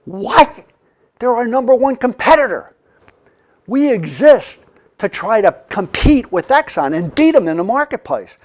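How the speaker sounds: retelling the shock of a merger; shocked disbelief. Retold or imitated. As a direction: sound stunned and offended.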